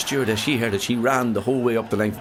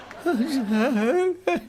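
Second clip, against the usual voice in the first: high hammed-up blubbering wail; mock crying wail. Drinker bawling after his pint is taken: high mock wail. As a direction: wail like a sad drunk in a bar.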